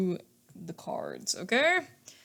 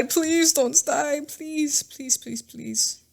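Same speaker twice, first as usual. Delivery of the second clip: pleading; 8 semitones higher, much slower than usual. Real, not acted. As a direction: beg the camera to hold on. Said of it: "I'm praying that the camera".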